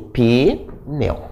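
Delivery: "pneu" broken in two, "pi... neu"; split into syllables. Retold or imitated. Teacher showing how a child separates the word, "pneu" split as pi-neu. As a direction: say "pneu" slowly as "pi", pause, "neu". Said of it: "Ela separa assim".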